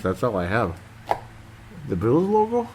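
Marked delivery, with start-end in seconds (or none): 1.9-2.7 "The Bills logo?" pitched way up in disbelief; incredulous echo. Real, not acted. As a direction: repeat it like you can't believe it. Echoes comment in disbelief.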